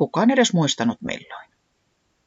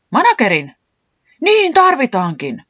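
high, loud burst of eagerness; enthusiastic; 7 semitones higher, 6 dB louder than usual. A narrator's reading. Puuhis takes up the manager idea, enthusiastic. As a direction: seize the idea excitedly. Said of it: "innostui Puuhis".

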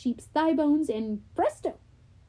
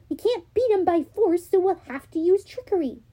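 Annabeth voice; 6 semitones higher than usual. Acted.

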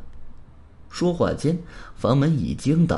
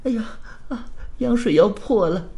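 higher-pitched, pained moan; 8 semitones higher than usual. Acted. Pregnant woman as her waters break, moaning in pain. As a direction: gasp it out through labour pains. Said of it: "孕妇开始痛苦地捂着肚子".

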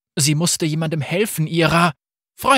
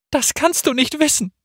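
sobbing; 14 semitones higher than usual. A narrator's reading. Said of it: "schluchzte Akali".

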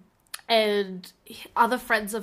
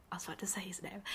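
whisper; 20 dB quieter, faster than usual. Real, not acted. Stops herself from saying name.

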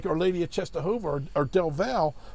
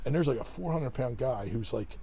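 usual speaking voice

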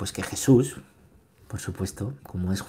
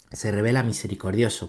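ordinary talk, nothing marked

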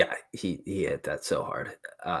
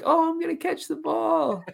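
put-on high, eager child voice; squeaky kid, excited to catch it; little kid voice. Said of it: "Some little kid's like".

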